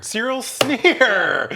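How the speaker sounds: loud, slow delighted shout; excited. Real, not acted. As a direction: call out the name in delight. Excited recognition of cartoon.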